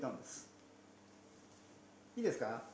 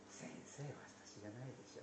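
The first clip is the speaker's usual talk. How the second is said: near-voiceless whisper, much softer; 13 dB quieter, faster than usual. Retold or imitated. Judas alone whispering to Jesus, nearly voiceless. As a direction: breathe it out alone, set apart from the murmur. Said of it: "でも、ここのところで".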